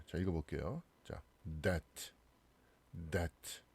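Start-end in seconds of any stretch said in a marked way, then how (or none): 1.6-3.6 "that" as det, no B; "that" pronounced det; silent B. Shown as a demonstration. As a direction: say "that" like debt with silent B. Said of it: "B가 발음이 다 안 되죠".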